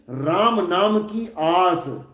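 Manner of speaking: ordinary talk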